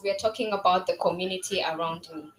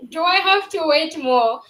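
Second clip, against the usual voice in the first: lightly mocked impatient father, higher and louder; higher, louder take on dad's complaint; imitating daddy; 6 semitones higher, 6 dB louder than usual. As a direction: raise your voice as a playfully imitated dad. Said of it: "Daddy was like".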